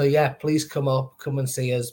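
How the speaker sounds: usual speaking voice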